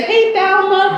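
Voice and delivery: a customer calling hello across the room; cheerful customer greeting. Retold or imitated. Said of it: "People come in here and say".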